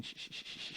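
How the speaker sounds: unvoiced shushing rub; quiet friction noise for the fire; rubbing stick noise. Shown as a demonstration. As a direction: breathe out the scrape of stick on stick. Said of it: "That's the noise of it".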